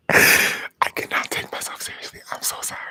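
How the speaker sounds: giggly, breathless whisper. Real, not acted. Apologizing to viewers for not taking it seriously, whispering. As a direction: whisper through suppressed laughter. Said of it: "I'm whispering".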